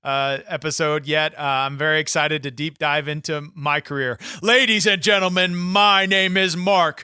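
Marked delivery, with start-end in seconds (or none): 4.4-7.0 grand entrance announcement. Acted. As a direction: announce yourself like a champ entering. Wrestler introduces himself grandly.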